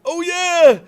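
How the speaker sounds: high, loud, mock-excited new streamer; impressionable newbie. Streamer imitating a new channel dazzled by subs, mock excitement. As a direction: gush with hammed-up greedy excitement. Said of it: "if you're a new impressionable channel".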